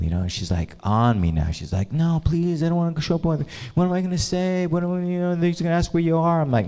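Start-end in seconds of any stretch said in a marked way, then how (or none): 1.9-6.4 high, softer mock pleading; hurried imitated plea in a high voice. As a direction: imitate a girlfriend begging, pitched up. Girlfriend pleads, retold.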